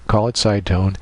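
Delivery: ordinary delivery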